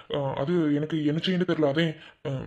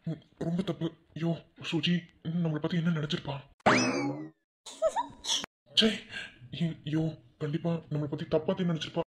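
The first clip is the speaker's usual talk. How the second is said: quiet; 6 dB quieter than usual. Acted. Regretful muttering to himself.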